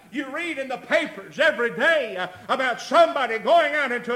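loud, high-pitched pulpit exhortation; full-force preaching. Real, not acted. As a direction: drive each phrase home to the congregation.

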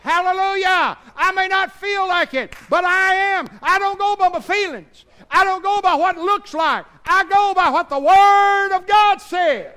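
loud, high shouted declaration; preaching at full force. Acted. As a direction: proclaim it triumphantly. Shouted confession of faith.

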